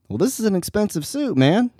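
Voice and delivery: put-on pitched well up, a whiny young man objecting; young man's protest. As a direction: sound put out about ruining the suit.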